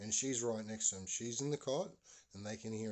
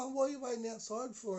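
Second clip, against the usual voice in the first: high, sing-out neighbourly greeting; friendly neighbour; 13 semitones higher, 3 dB louder than usual. Retold or imitated. Neighbour greets Ryan waiting outside, friendly high voice. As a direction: greet the boy in a much higher voice. Neighbour questions boy in retold story.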